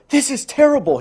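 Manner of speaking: high, loud distressed cry. A narrator's reading. Lizard crying out in dismay at his changing body. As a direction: wail it out in dismay. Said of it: "he cried".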